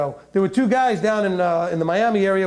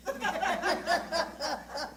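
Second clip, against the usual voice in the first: high, breathy burst of real laughter; cracking up; 10 semitones higher, 11 dB quieter than usual. Real, not acted. Laughs at own joke.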